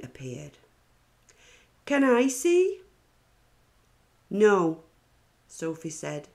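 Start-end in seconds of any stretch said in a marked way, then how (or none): 1.8-2.8 put-on high, loud eager kid's plea; eager child asking. Stephen begging Sophie to see her drawing, high and eager. 4.3-4.8 loud, flat-out no; firm refusal. Acted. Sophie refusing to show Stephen her picture, loud and firm.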